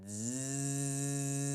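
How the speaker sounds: long buzzing zzz through the teeth; bee buzz. Shown as a demonstration. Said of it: "this buzzing noise".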